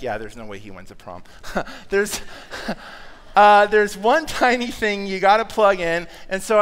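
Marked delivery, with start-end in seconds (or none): 0.0-1.2 mock listener sneer. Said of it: "And you're like". 1.3-1.8 soft laugh. Real, not acted. Laughs at own joke. 2.2-3.3 breathy chuckle. Real, not acted. Laughs at own joke.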